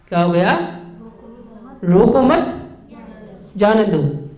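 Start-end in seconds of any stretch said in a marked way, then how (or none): 1.8-4.3 रोको मत rising as one unit, then जाने दो; pause after मत. Shown as a demonstration. Teacher showing same words with new meaning, pause after मत. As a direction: hold the break until after मत. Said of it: "शब्दों में कहीं हेर फेर हुआ क्या?".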